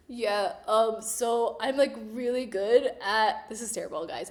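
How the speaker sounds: ordinary talk, nothing marked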